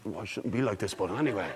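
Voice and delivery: put-on quiet, grudging teen mumble; soft mock-sulky teenager; reluctant teenager. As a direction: teens admitting they're gripped, grudgingly. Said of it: "teenage Belgians that were going".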